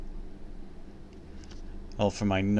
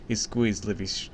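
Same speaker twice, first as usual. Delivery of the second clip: ordinary delivery, nothing marked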